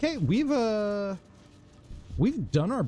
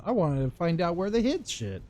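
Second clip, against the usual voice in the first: ordinary talk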